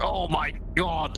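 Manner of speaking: drawn-out shocked "Oh my god"; slow stunned exclamation. Real, not acted. Shocked by bright sun filter.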